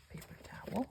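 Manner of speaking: soft unvoiced whisper. Real, not acted. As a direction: breathe it out as a whisper. Whispered aside.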